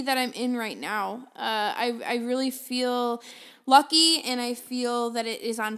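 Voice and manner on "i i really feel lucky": normal talking voice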